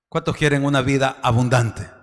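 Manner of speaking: ordinary talk, nothing marked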